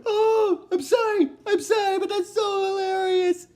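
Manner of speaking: high-pitched laughing apology; can't stop laughing while apologizing; real giggling, high and breaking; laughing fit. Real, not acted.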